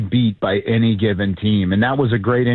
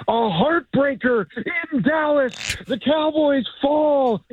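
high, slow dramatic announcer call; hammed-up high broadcast sign-off; play-by-play call; 13 semitones higher, slower than usual. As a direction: stretch it out like a game-ending broadcast. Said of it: "I'm a play-by-play announcer".

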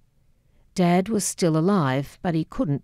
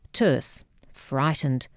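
ordinary delivery

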